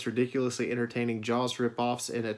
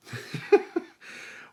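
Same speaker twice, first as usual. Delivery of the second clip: breathy laugh. Real, not acted. Laughing at own sarcasm.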